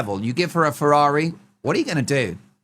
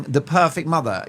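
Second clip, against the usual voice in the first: ordinary talk, nothing marked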